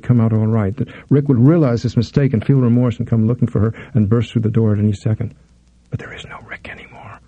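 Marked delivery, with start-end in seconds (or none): 5.9-7.2 voiceless breathy whisper, barely there; terrified whisper. Acted. Lydia whispering to herself, alone with captor: terrified whisper. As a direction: hush it like a thought you dare not say aloud. Lydia's panicked inner thought.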